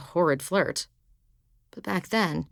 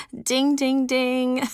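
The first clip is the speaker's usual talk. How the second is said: laughing; 9 semitones higher, 7 dB louder, much slower than usual. Acted.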